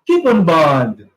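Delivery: coupon bond said low, "KIU-pon band"; teacher modelling the phrase; correct pronunciation. Shown as a demonstration.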